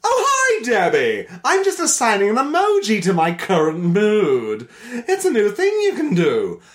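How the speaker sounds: very high-pitched put-on chirpy character; sing-song greeting in a silly high voice; Pussy Pals voice. Pussy Pal Carol telling Debbie about emojis, hammed-up character voice. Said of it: "start it in the Pussy Pal's voice".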